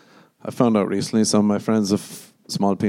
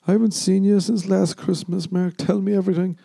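mock aunt voice, higher; chirpy aunt at Christmas, pitched up; imitating aunt; 9 semitones higher than usual. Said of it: "something my aunt would say".

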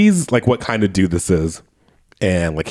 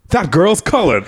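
mock shock, pitched up high; retold shocked outburst; 8 semitones higher than usual. Movie judge discovers he was fooled, shocked. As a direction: cry out in imitated astonishment.

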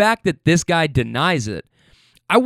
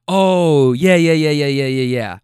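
sarcastic, louder run of yeah, yeah, yeah that fades out; mocking yeah yeah; 4 dB louder than usual. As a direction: rattle the yeahs off like you've heard it all before. Buddy mocking Hanks's denial.

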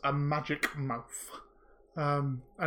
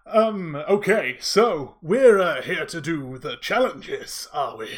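magic mouth voice; 4 dB louder than usual. Acted. Game master voicing the magic mouth to the players, lightly put-on. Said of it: "I will try my best to voice act".

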